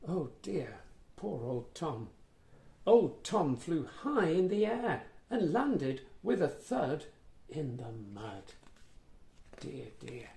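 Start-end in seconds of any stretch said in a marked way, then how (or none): none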